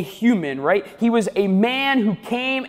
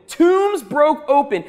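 building intensity; 7 semitones higher, 4 dB louder, slower than usual. Acted. Building list of wonders.

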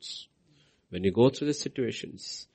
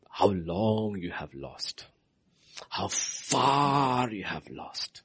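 slow, drawn-out preaching; slow, deliberate preaching; slow emphasis; 3 dB quieter, much slower than usual. Acted. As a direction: stretch each word out.

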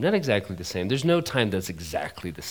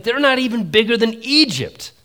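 much higher, louder exasperation; exasperated exclaim; 13 semitones higher, 9 dB louder than usual. Real, not acted. Preacher to congregation, high loud exasperated outburst. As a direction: throw up your hands in disbelief.

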